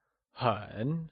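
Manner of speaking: 很 slow and soft, dipping then rising; 很 as a careful Mandarin third tone; falling-rising tone. Shown as a demonstration. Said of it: "also pronounced using a falling".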